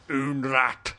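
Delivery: put-on "Unrat" with no H and a hard final T, Polish-accented; mock Polish accent. Adamski naming his acquaintance, "Unrat" in mock Polish accent. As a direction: say "Unrat" as a Polish caricature, H dropped. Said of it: "used to call Hunrath, like".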